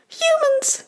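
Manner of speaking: tiny, extremely high goblin squeak. Acted. Young goblin hearing of humans, high squeak. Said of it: "squeaks another of the goblins".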